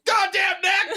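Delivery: high, exasperated yell at his own neck; frustrated shout. Pretend. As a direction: blurt it out, fed up. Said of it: "he's like".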